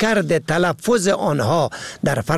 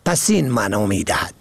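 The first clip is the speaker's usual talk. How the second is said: normal talking voice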